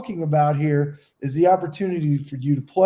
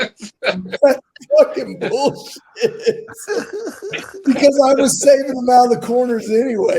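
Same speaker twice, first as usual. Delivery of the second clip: worked-up, higher voice, stretching the curse; indignant protest; 7 semitones higher, 4 dB louder than usual. Real, not acted. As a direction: protest your innocence over friends' laughter. Teased friend protests amid laughter.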